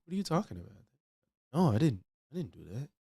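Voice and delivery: very soft, hushed denial; unconvincing meek denial. Acted. Commentator showing how a liar would sound, very softly. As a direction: protest feebly, barely above a murmur. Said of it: "if I spoke like that".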